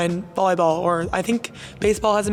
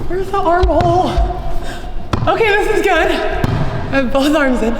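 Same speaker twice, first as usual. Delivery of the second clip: loud, high excited exclaiming; yelling while struggling, really excited; 7 semitones higher, 6 dB louder than usual. Real, not acted. Struggling with the sweatshirt challenge.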